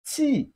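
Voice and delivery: "T" as a short, high burst of air; strong airflow. Shown as a demonstration. Teacher shows how to make the sound, airy short "T". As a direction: push air hard through a brief high "T". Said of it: "a very strong airflow".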